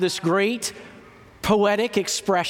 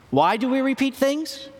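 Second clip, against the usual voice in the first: high rising prompt to the audience; 7 semitones higher than usual. Shown as a demonstration. Preacher repeating the question to the congregation, high and rising. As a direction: ask "repeat" much higher, rising, wanting an answer.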